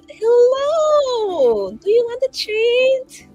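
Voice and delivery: high, drawn-out singsong pet voice; coaxing an animal. Acted. Player to the raven with a note, high coaxing coo. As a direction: sing it out slow and high, like luring a pet.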